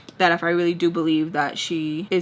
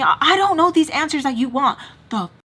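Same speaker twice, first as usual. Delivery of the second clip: higher, louder, snippy retort; getting attitude; 6 semitones higher, 4 dB louder than usual. Retold or imitated. Answering her partner's demands about his daughter, higher and louder with attitude. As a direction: push back, exasperated and sharp. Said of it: "So I started getting a little attitude".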